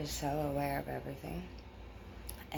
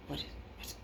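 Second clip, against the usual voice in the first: hushed real whisper, afraid to be heard; 3 semitones higher, 12 dB quieter, faster than usual. Real, not acted. Whispering to viewers after hearing a noise. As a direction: whisper so nobody else in the house hears.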